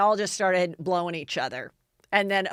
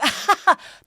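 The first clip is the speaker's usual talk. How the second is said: a big high burst of laughter; hard laugh; 10 semitones higher, 6 dB louder than usual. Retold or imitated. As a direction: laugh hard at nothing funny. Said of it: "he laughs really hard at things".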